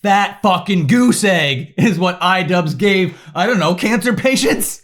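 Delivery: normal talking voice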